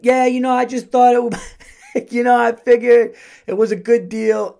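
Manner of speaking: high, loud, defensive squeak of an excuse; laughing through a feeble, high-pitched defense; sheepish excuse. Retold or imitated. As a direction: imitate a caught husband justifying himself. Husband makes excuses to wife.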